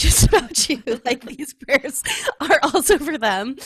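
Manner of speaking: amused imitation of a divine telling-off; laughing mock rebuke. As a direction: playfully scold through a laugh. Laughingly voices God's gentle rebuke.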